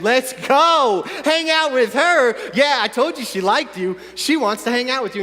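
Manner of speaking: loud, high cheering; triumphant hype, first words drawn out; hyped-up inner voice. Acted. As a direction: whoop it up like an overexcited buddy. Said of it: "Voice comes back in".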